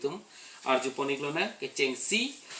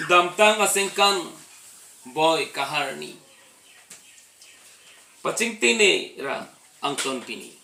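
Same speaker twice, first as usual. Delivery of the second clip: declaimed line by line with long pauses; verse recitation; 3 semitones higher, 6 dB louder, slower than usual. Acted. Reciting Karbi Ningkan Kimi verses for channel viewers, measured declamation. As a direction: start each phrase strong and raised, then let it fall away.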